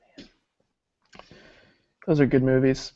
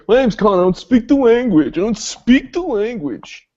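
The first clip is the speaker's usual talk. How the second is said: mocking impression; 9 semitones higher than usual. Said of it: "Here we go, here we go".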